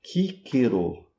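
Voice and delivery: "Cícero" slowly with hard k sounds, kee-keh-ro; Latin pronunciation. Shown as a demonstration. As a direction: say "Cícero" the classical Latin way, both c's as k. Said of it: "como é que é pronunciado em latim?".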